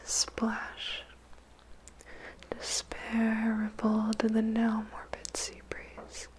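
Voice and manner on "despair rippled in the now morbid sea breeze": ordinary delivery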